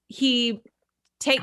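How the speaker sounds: ordinary talk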